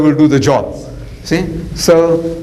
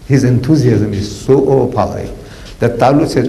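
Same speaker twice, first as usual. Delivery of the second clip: ordinary talk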